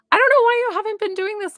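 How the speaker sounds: fast, high and loud excited gush. Real, not acted. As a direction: blurt it out in eager disbelief. Excitedly urging the viewer.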